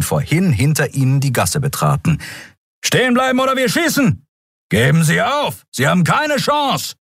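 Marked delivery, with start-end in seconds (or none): 2.8-6.9 shouted command. Acted. Pursuers order fugitives to stop.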